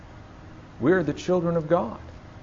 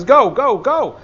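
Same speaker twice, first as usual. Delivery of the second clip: high, loud, insistent go go go; exasperated command; 10 semitones higher, 7 dB louder, slower than usual. Retold or imitated. God losing patience with a disobedient child, shouting go. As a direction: order them out with rising impatience. God's command, third time.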